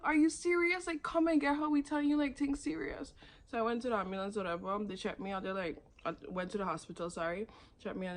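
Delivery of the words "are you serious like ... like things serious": high-pitched, exasperated retort; retold argument, voice pitched right up; indignant urging. Helpers pleading with the ambulance service, indignant urging.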